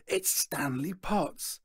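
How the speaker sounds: hushed, drawn-out whisper of recognition; excited crowd whisper. A narrator's reading. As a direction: whisper it slowly, like news passing round a crowd. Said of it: "the whisper goes".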